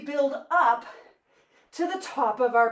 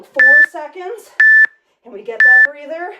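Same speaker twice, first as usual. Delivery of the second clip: shouted countdown; loud call; 14 dB louder than usual. Real, not acted. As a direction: push them through the last seconds. Coach counts down to rest.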